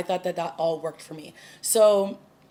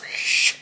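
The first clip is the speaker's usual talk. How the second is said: imitated rushing whoosh; 3 dB louder than usual. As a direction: make a quick hissing whoosh noise. Imitates Barry rushing through bubble.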